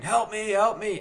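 half-voiced, high pleading call; retold scream for help. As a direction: imitate a man yelling for help, volume held back. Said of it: "guy sitting there screaming".

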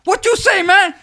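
loud, high-pitched comic Mexican dealer; Mexican accent. Acted. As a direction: shout it in a hammed-up Mexican accent. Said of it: "he sounds like a Mexican".

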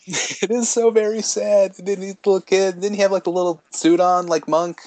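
high-pitched, gushing heartache; choked up. Real, not acted. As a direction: dwell on it, touched and pitched high. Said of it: "get choked up".

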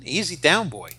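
ordinary delivery

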